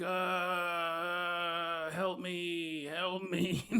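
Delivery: soft moaning zombie plea. Acted. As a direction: moan weakly for help. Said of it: "He's just complaining".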